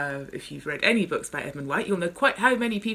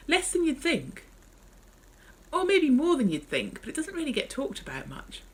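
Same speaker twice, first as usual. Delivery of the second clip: wry reply; 10 semitones higher than usual. Acted. Narrator answers, voice shifted.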